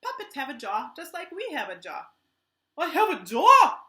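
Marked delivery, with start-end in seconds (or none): 2.8-3.8 loud, slow, higher proud puppet shout; excited shout. Pretend. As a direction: announce it to the world.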